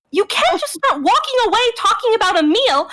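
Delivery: high, emphatic indignant rebuke; indignant scolding. Acted. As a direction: scold a wayward brother, pitched high. Sister scolds brother in character.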